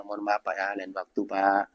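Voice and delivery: soft, polite refusal; gentle refusal. Shown as a demonstration. Preacher shows a gentle refusal to a beggar, soft and polite. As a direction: turn the beggar away gently.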